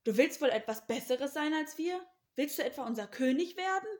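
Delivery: loud, indignant questioning; cross brothers rounding on him; snapping angrily. A narrator's reading. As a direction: challenge him with scornful outrage. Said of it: "fuhren sie Josef an".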